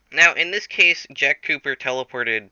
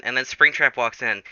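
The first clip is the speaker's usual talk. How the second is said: ordinary talk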